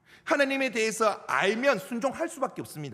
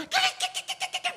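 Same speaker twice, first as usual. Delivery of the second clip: high, slow yelping whine of a scared dog; dog whimper; slower than usual. Retold or imitated. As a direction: yelp like a beaten dog. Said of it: "막 이러잖아요". (겁먹은 개처럼 높게 낑낑대는 소리)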